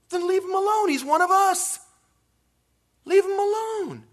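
very high, loud acted shout; shouted command. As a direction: cry it out, defending the man. Voicing Jesus' order to disciples.

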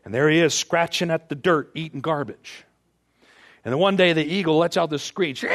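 normal talking voice

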